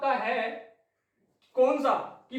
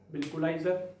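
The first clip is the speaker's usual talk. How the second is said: a lower, softer pupil's answer, lightly voiced; student replying; 7 semitones lower, 4 dB quieter than usual. Teacher voicing a student answering his question, lightly voiced pupil. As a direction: play the obedient student saying yes sir.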